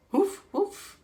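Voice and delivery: high playful woof woof; high mock dog bark. Shown as a demonstration. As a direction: bark like a friendly dog for the kids. Dog noise for children.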